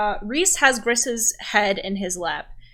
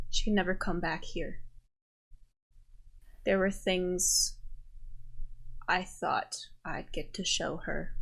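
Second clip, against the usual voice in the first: a lightly voiced, softer grieving Reese; grieving druid; 5 dB quieter than usual.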